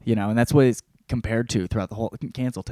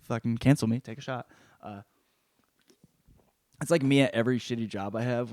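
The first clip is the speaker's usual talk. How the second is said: normal talking voice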